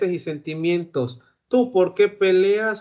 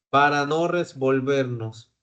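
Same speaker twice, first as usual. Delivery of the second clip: normal talking voice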